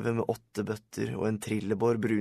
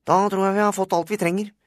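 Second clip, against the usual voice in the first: put-on very high, loud boy reporter voice; high-pitched reporter calling out to his subjects; eager school-paper reporter; 10 semitones higher, 7 dB louder, faster than usual. A narrator's reading. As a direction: fire questions high and loud, like a pushy photographer.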